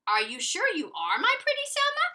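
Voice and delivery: high, suspicious granny question; Granny doubting the dog; doubtful granny. Acted. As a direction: ask it warily. Granny suspicious, voiced in a story.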